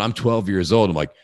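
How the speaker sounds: normal talking voice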